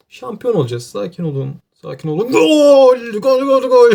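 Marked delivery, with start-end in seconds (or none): 2.3-3.9 high, loud goal scream; real joyful goal cry; goal shout. Real, not acted. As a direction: yell it like the winner just went in. His team scores the title goal.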